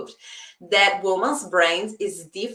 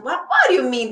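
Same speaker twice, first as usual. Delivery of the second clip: higher-pitched mock protest; arguing back; 5 semitones higher, 4 dB louder, faster than usual. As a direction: protest against your own inner voice. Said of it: "I start reasoning".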